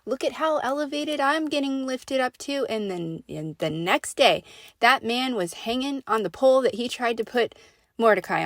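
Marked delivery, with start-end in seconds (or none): 0.1-2.6 high-pitched mock bragging; boastful Haman. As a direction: gloat in a put-on, raised voice. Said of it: "Haman thought he got away with it too".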